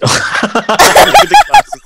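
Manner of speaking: high, slow laughing words. Real, not acted. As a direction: talk through laughter. Laughing at glasses.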